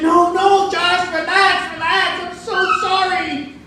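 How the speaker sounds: put-on high, slow pleading girlfriend; calm him down with a drawn-out high plea; fiancée pleading. Fiancée begging Josh to stop fighting, high and slow pleading. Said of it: "She's like".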